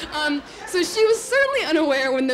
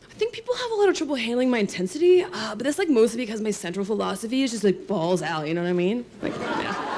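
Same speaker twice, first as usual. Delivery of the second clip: lightly voiced laid-back guy, in earnest; a hint of a self-serious college bro; dead serious stoner guy. Retelling a classmate's solemn boast, mild stoner voice. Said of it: "in all seriousness".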